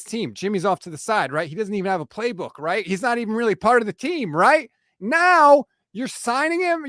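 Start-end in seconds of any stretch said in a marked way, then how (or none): 5.0-6.9 higher, louder, slow punch of outrage; outraged rant. Real, not acted. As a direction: hit it like an accusation. Ranting at team.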